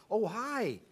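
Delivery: high, drawn-out American phone greeting; American accent. Retold or imitated. Teenager answering the family phone, put-on American accent. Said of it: "I'd be talking like this".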